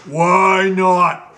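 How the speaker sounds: loud sharp demand; impatient royal retort; Gnome King snapping. Acted. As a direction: bark the question at him. King snaps at the steward.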